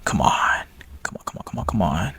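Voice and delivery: breathy whispered come on; whispered urging. Real, not acted. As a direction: hiss it under your breath. Whispering at the new error.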